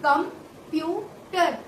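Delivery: कंप्यूटर very slowly, each syllable apart: कम-प्यू-टर; slow syllable breakdown. Shown as a demonstration. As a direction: stretch कंप्यूटर out, part by part. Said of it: "third word में एक और". (कंप्यूटर बहुत धीरे, हर हिस्सा अलग)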